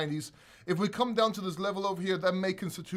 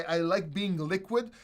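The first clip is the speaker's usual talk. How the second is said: normal talking voice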